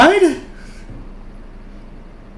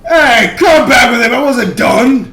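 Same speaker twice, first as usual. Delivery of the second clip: shouted protest, louder and higher; angry shout; 5 semitones higher, 6 dB louder, slower than usual. Acted. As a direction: yell after the bird to come back. Player yells at fleeing raven.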